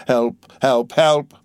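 rising cry for help; urgent narrated plea for rescue; calling for help. A narrator's reading. Dantes cries out for succor.

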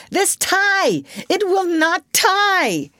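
very high, exasperated outburst; yelling. A narrator's reading. Father fights with his tie, yelling. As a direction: cry out in fury, drawing out the last words. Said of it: "He really yelled".

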